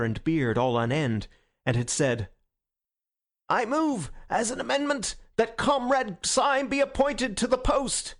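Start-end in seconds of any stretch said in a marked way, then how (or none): 3.5-8.1 high-pitched, urgent proposal to the meeting; excited motion. A narrator's reading. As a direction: leap in with a ringing motion. Witherspoon leaps up, moves amendment.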